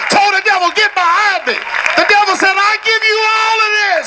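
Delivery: high, driving sermon cry, building; full-force preaching. Real, not acted. Preacher telling of Jesus tempted on the mountain, fervent shouting.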